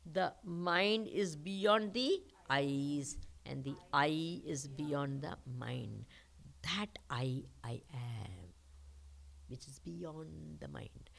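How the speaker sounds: soft, slow, near-whispered truths; hushed meditative voice. Acted. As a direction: draw them inward, hushed. Softens into a meditative teaching.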